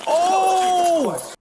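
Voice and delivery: sudden high frightened yelp; startled yelp. Real, not acted. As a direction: jump out of your skin. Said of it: "That scared the shit out of me".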